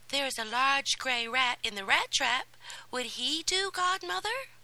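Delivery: put-on excited girl asking her godmother; eager Cinderella. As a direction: offer the rat hopefully, rising at the end.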